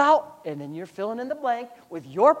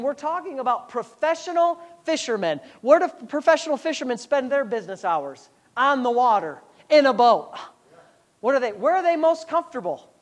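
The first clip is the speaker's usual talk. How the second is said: ordinary talk, nothing marked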